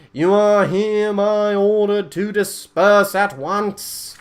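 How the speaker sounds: slow, loud, high proclamation from the podium; stern herald ordering a crowd to disperse; pompous Inquisitor decree. Acted. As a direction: declaim it like a self-important mayor. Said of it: "I don't think Andrew Tate has the voice of, of Mayor Quimby".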